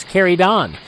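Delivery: normal talking voice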